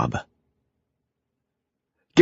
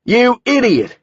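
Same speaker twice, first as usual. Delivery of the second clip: furious shout, much higher and louder; yelled; 5 dB louder than usual. A narrator's reading. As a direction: bellow it down the stairs in anger. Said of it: "Gates yelled down".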